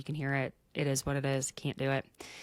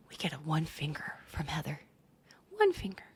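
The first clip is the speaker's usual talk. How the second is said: whisper; 4 semitones lower, 5 dB quieter than usual. Real, not acted. Gossipy aside.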